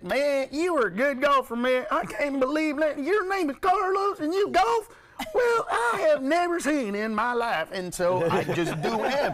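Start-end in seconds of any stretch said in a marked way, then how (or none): 0.0-7.7 imitating a Southern Alabama buddy, much higher and louder; Alabama accent. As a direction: do Eric from Alabama amazed at a golfer named Carlos. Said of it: "And he talked like this".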